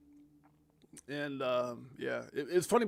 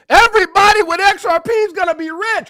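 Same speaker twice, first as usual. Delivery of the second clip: much higher, louder hype yell; ecstatic shout; 21 dB louder than usual. Shown as a demonstration. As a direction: scream the good news to the crypto army. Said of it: "a decoder in my brain".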